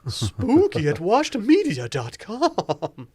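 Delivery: high, slow spooky laugh; sinister laugh. Acted. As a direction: cackle the address like a villain. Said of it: "That's a pretty sinister laugh".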